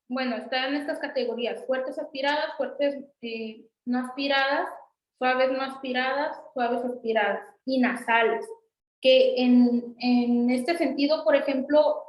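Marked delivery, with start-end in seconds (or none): none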